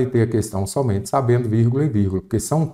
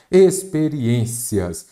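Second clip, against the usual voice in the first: Experiências drawn out slowly, higher pitched; slow sounding-out; 4 semitones higher, much slower than usual. Shown as a demonstration. Teacher sounds out the exam word, Experiências slow and stretched. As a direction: stretch Experiências so each syllable can be heard.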